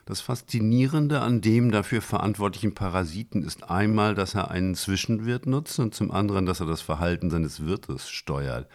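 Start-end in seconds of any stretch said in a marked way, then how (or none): none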